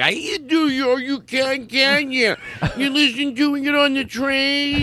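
high, drawn-out silly mockery; mock lunatic laughing. Host teasing a listener on the train, high lunatic mockery. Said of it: "not gonna be a lunatic laughing".

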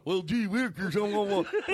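mock the dopey imitation; moron. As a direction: simpleton parody voice. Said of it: "make me sound like a moron".

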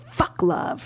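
breathy, bitter spit of a curse; bitter dismissal. Retold or imitated. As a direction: mutter it like a jilted bride. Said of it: "she was like".

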